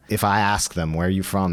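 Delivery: ordinary talk, nothing marked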